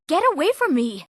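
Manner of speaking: high-pitched, urgent protest; alarmed warning. Acted. Telling a threatening stranger to get away, alarmed. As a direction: push them away with a high, alarmed cry. Character drives off someone threatening.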